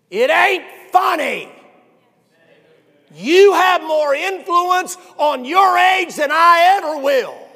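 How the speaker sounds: loud, high, slow shouted rebuke; fiery pulpit shout; preaching at full force. Real, not acted. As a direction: scold the crowd from the pulpit.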